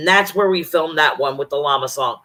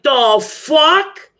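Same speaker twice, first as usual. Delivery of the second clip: shocked outrage; 7 semitones higher, 4 dB louder than usual. Retold or imitated. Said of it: "I'm like".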